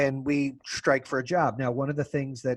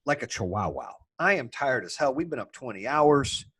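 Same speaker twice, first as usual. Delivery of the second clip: ordinary talk, nothing marked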